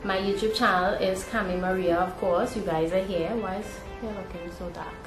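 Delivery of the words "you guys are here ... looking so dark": usual speaking voice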